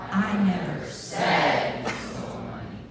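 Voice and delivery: said punched out in a slow line; stress on said. Shown as a demonstration. Group practicing one sentence together, stress on said. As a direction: hit the word said hard.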